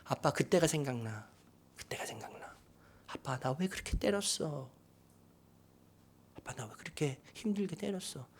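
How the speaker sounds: hushed, fading into whispers; soft, pained reproach to a father; tearful son, retold. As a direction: quietly voice a hurt child remembering. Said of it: "아들이 또 막 울기 시작하는".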